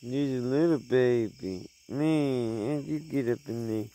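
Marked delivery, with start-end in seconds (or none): none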